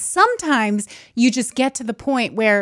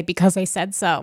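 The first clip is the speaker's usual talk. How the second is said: curt parental brush-off, lower; a parent shutting the question down; mock stern parent; imitating a parent; 4 semitones lower than usual. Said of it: "when one of my parents would say".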